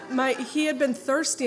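high, loud laughing words. Real, not acted. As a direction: laugh through the line. Laughing at the memory.

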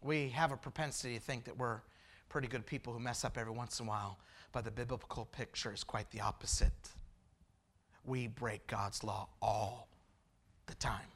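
dropped to a soft, confiding voice; soft preaching. Acted. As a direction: lean in and speak gently to the room. Quiet reflective aside to congregation.

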